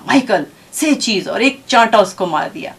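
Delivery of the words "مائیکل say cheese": imitated exasperated mother, mildly sharp; retold last-straw command, understated anger; very angry mother. Mother at wits' end orders child to pose, furiously. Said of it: "ماں نے بہت غصے سے کہا".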